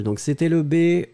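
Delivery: ordinary talk, nothing marked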